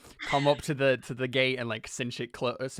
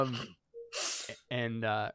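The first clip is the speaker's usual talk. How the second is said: ordinary delivery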